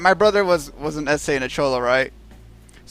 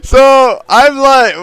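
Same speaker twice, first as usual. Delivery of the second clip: laughing; 7 semitones higher, 10 dB louder, much slower than usual. Real, not acted. Still laughing, retelling.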